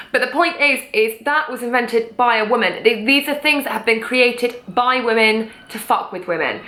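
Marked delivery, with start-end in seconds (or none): none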